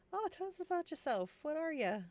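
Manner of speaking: high, nosy tavern patron; tavern-table character. Pretend. Tavern patron quizzing a newcomer at the table, high mock curiosity. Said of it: "I could just see it in the tavern table".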